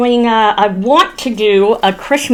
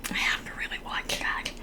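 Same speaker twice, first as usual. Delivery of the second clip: whispered aside; 22 dB quieter, faster than usual. Real, not acted. Self-reproach muttered aside.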